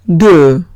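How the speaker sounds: Deux said low with rounded eu /dø/; deux, lips rounded on eu; French number deux. Shown as a demonstration. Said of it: "Siguiente palabra es deux".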